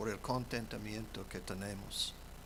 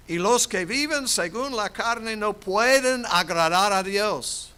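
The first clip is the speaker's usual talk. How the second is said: loud, higher preaching cry; full-force preaching; 9 semitones higher, 12 dB louder than usual. Acted. Pastor proclaiming to the congregation, loud preaching. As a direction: preach it out to the whole church.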